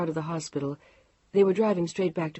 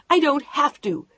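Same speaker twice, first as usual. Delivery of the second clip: high, loud defiant shout; wife shouting at her husband; 9 semitones higher, 7 dB louder than usual. Acted. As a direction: shout it back at him. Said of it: "she was shouting at him now".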